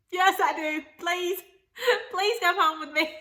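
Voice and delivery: pleading through tears with a laugh; teary laughing plea. Real, not acted. Asking her mom to come home, teary and laughing. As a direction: beg her mom, half crying, half laughing. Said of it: "laughed while I cried".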